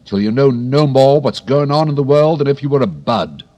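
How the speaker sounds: loud, rising scold; scolding cry. Acted. Tiger Lily scolds the violet, loud. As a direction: put the violet in its place. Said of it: "cried the Tiger Lily".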